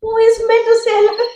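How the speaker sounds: who on earth is that; baffled question. Real, not acted. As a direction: ask it bewildered. Baffled by unknown name.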